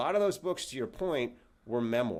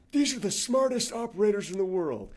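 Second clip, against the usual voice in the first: higher, louder retold commander's announcement; a proud officer presenting his team, somewhat raised; commander introducing; 8 semitones higher, 5 dB louder than usual. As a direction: announce it like the man in charge. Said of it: "points to me and goes".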